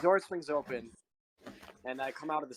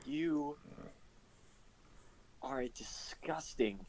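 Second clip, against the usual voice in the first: hissed whisper; 10 dB quieter than usual. Acted. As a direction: whisper it with disgust. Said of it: "unvoiced whisper".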